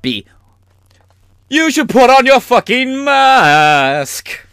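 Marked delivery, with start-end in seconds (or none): 1.5-4.5 high, loud, slow campy rock-star voice. Pretend. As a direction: belt the order like a flamboyant frontman. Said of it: "That would be Mercury".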